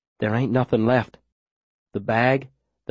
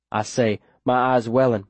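plain reading voice

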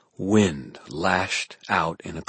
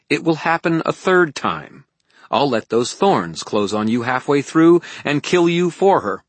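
high, loud, forceful threat; fierce warning of betrayal; heated threat; 9 semitones higher, 9 dB louder than usual. Acted. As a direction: throw the threat at him, voice raised.

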